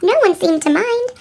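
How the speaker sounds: plain reading voice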